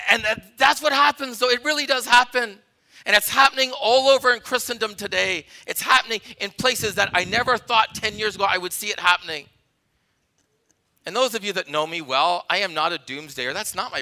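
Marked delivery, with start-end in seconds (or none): 0.1-9.5 loud, raised sermon delivery; emphatic preacher, pitched up and punching key words; full-force preaching. Real, not acted. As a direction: drive each point home to the congregation.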